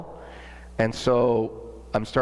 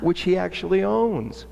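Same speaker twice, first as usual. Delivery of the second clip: imitating the marketing lady; 8 semitones higher than usual. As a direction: play the sympathetic ex-employee in a higher voice.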